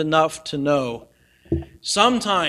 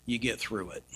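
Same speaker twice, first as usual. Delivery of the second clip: quiet; 8 semitones lower, 14 dB quieter than usual. Real, not acted. Emphatic contrast with line 97.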